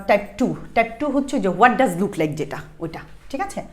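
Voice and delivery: ordinary talk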